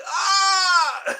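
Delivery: high, loud hammed-up scream; mock mobster scream. Telling Eddie how mobsters scream, a hammed-up high scream. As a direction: let out a cartoon mobster's scream. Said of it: "You hear mobsters screaming".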